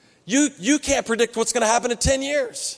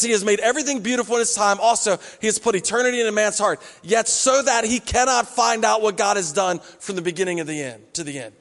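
quick reread. Shown as a demonstration. Pastor rereads the verse quickly to show the comma. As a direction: run through the verse again at speed. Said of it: "He says".

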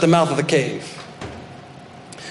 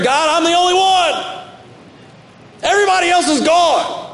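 much higher, shouted lament to God; self-pitying prayer; 12 semitones higher than usual. Acted. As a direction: wail it like the only faithful one left. Praying, self-pitying cry to God.

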